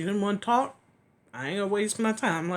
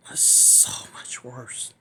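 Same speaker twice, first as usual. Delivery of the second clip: whispered; 6 semitones lower, slower than usual. Real, not acted. Weight of spiritual pain.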